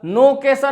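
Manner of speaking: नो कैसा as no-ke-sa, open vowels; majhul, wrong way. Shown as a demonstration. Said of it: "नहीं पढ़ना आपको". (नो कैसा, मजहूल आवाज़ में)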